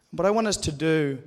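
normal talking voice

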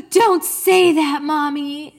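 put-on high, loud, slow little-girl protest; imitated scolding child. Mom imitating her daughter begging her not to say dead. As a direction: plead like a shocked little girl.

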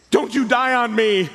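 dramatic plea. Acted. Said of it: "and I say".

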